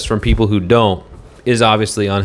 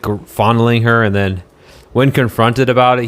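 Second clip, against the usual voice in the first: normal talking voice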